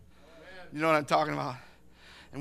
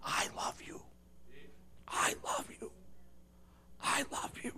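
hushed, airy whisper; echoing cry, whispered; 6 semitones higher, 12 dB quieter than usual. Acted. Echoes of Calvary calling to the world, whispered. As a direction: whisper it like a distant echo. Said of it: "echoes of Calvary still cry out".

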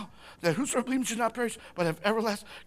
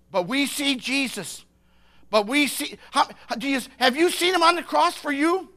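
building preaching; 6 semitones higher, 6 dB louder than usual. Real, not acted. Preacher asking the congregation if they see Jesus, building intensity. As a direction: voice climbing and getting louder.

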